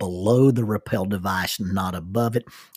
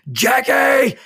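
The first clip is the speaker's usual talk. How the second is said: a high, loud scream of the name; 14 semitones higher, 8 dB louder than usual. Retold or imitated. Upside down on the rope, screaming for his wife: a high, loud scream. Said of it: "screaming at the top of my lungs".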